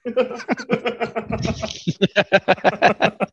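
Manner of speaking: long, high-pitched laugh; sustained high giggling fit. Real, not acted. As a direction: crack up in a high run of laughter. Laughing at the Jupiter answer.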